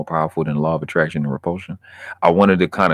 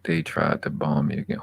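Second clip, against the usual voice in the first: ordinary talk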